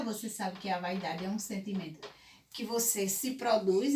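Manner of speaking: ordinary talk, nothing marked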